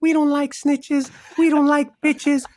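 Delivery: put-on very high, squeaky twelve-year-old rap hook; squeaky kid rapper. Rapper imitating his twelve-year-old self on an old chorus, squeaky. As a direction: mimic your childhood rap in a tiny squeal. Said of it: "me with a fucking squeaky voice".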